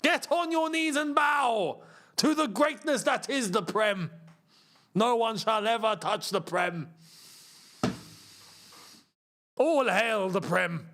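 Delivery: hammed-up, stately decree to the faithful; mock solemn decree. Fan orders all to bow to the Prem, mock solemn decree. Mock worship of the Premier League.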